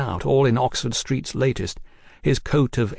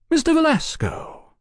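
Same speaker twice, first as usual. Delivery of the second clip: high, cordial greeting with a smile; smiling, swooping high then falling. A narrator's reading. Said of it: "Oliphant smiled".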